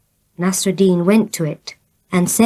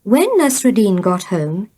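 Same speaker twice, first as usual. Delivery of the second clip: plain reading voice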